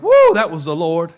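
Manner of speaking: loud, excited whoop of realization; excited exclamation. Retold or imitated. Pastor reliving the moment he heard God, loud excited exclamation. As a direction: burst out with amazed joy.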